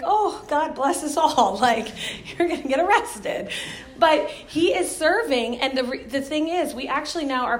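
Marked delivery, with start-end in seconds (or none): 0.0-3.5 laughing disbelief at him; teasing her husband about getting arrested; mock exasperation. Her own mock-exasperated reply.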